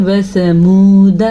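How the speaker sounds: وثمود ending on the d, no alif sounded; wasamud without alif. Shown as a demonstration. Teacher asking students to listen again, slowed wasamud. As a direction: say وثمود slowly, alif silent. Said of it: "پھر سنیں".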